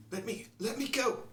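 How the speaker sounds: high-pitched struggling cry; woman in distress. Acted. Game master voicing another robbed woman, distressed. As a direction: plead high, in broken bursts. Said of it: "another female voice that is in distress".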